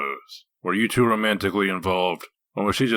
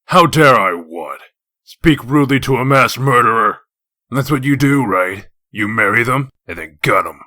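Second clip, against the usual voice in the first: loud, sneering attack on the suspect; angry accusation; 3 semitones higher, 8 dB louder than usual. Acted. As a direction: throw the charge in his face. Detective confronts the suspect.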